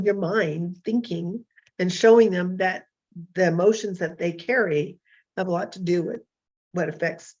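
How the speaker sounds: usual speaking voice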